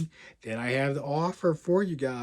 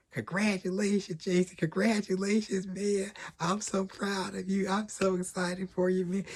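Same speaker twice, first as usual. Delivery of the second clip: higher, gushing congratulations from the tutor; excited congratulations; 7 semitones higher than usual. Retold or imitated. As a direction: imitate the proud tutor, beaming. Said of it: "That's how excited I am for you".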